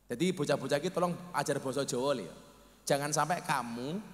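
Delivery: ordinary talk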